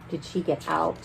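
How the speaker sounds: hushed worried question. Real, not acted. Teacher asking children in hushed suspense.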